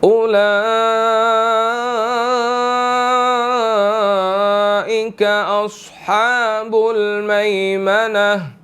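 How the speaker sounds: very slow melodic tajweed recitation; Quran recitation. Shown as a demonstration. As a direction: draw each word out in chant. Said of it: "recites the verse, teacher". (धीमी सुरीली तिलावत)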